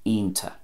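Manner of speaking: "ionta" as EEN-ta; Ulster pronunciation. Shown as a demonstration. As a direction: say "ionta" with no ch at the end. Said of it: "and Ulster".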